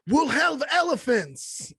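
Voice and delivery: high, slow mock showman voice; hammed-up ringmaster announcing; Showman singer. Quoting the Greatest Showman in a high showman voice. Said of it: "he's like".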